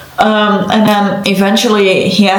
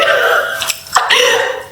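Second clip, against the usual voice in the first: giddy, breathy burst of laughter; delighted laugh; 3 dB quieter than usual. Real, not acted. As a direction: laugh happily at sweet news. Delighted laugh at host's message.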